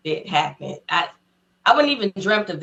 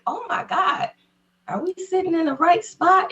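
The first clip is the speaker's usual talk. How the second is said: awed worry; 12 semitones higher than usual. Retold or imitated. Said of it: "I'm like".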